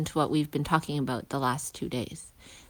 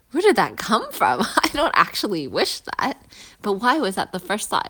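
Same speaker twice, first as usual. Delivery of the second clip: higher and louder, aghast with a laugh; mock shock; 4 semitones higher, 7 dB louder than usual. Person shocked by their own thought, mock alarm. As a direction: sound caught out and appalled.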